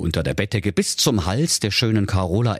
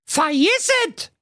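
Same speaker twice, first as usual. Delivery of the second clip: piercingly high princess cry; high, emphatic outburst; called out. A narrator's reading. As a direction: refuse in a very high character voice. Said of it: "rief da die Carola".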